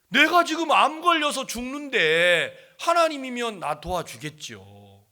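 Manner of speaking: loud, high plea, drawn out; retold child's protest. Sick child answering the uncle, loud protest. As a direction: imitate an indignant child, slowing and dropping at the end.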